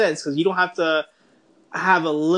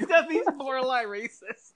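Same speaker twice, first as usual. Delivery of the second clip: ordinary talk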